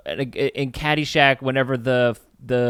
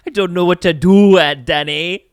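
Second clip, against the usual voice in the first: higher, louder Irish lilt; Irish accent; 5 semitones higher, 7 dB louder than usual. Retold or imitated. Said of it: "She has an Irish accent".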